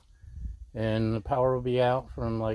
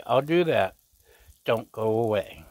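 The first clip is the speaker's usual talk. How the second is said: usual speaking voice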